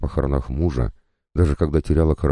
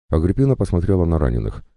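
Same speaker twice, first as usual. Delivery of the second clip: plain reading voice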